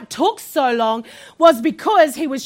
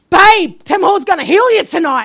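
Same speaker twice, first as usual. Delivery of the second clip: acted burst of excited news; 4 semitones higher, 7 dB louder than usual. Husband coming home with news for his wife, excited. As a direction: burst out with it, loud on the first word. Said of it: "he's so excited".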